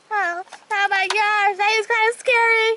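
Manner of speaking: ordinary talk, nothing marked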